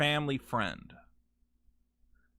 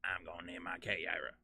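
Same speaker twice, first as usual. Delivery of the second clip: much lower and softer; quiet murmur; 11 semitones lower, 16 dB quieter than usual. Real, not acted. Streamer murmuring softly to his cat.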